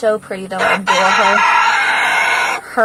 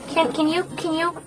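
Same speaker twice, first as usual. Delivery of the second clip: much higher, sweet coaxing voice to the bird; coaxing pet talk; 9 semitones higher than usual. Real, not acted. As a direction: sweet-talk the hen into showing off. Coaxing a hen to show itself.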